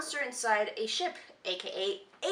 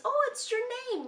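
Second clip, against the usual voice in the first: much higher, cheerful voice; a happy discovery, sung out high; delighted Wander; 13 semitones higher than usual. Retold or imitated. Wander to the creature about the dog tag, high and delighted. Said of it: "and he thinks".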